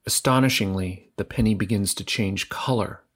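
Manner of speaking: ordinary delivery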